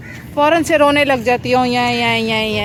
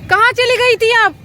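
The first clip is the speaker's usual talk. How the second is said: high mock-scolding; 10 semitones higher than usual. Real, not acted. Mother questions runaway child.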